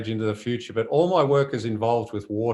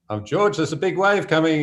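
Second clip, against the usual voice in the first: raised, higher-pitched heads-up, retold; excited alert; 7 semitones higher, 4 dB louder than usual. Photographer retells warning the boat captain of a wave, excited alert. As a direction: act out alerting the skipper to something coming.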